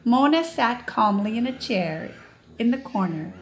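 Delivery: usual speaking voice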